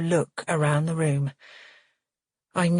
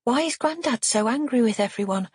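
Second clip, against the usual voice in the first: put-on high, puzzled child's voice; genuinely puzzled; 7 semitones higher than usual. A narrator's reading. Child asking about angry Grandad, genuinely puzzled. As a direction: ask innocently, all bewilderment. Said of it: "sounding genuinely puzzled".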